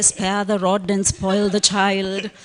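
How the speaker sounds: loud, slow, preacher-like pronouncement; booming Bible quote. Retold or imitated. Quoting the Bible to parents in a loud, slow preaching tone. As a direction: proclaim it like a sermon from the pulpit. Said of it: "I can quote the Bible and say".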